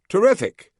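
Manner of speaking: suddenly pleased, all ears; eager relief. A narrator's reading. Hotheaded agent on the phone, suddenly eager. Hothead buying the fake story.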